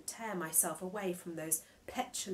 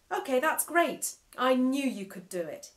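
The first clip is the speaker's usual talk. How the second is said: higher and louder, every word pressed; emphatically; 6 semitones higher, 8 dB louder than usual. Acted. Screen mouth emphatically praising the boy. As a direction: cheer him on with heavy emphasis. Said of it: "he said emphatically".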